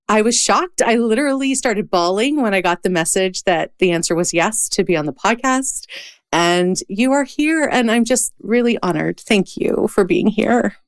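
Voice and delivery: normal talking voice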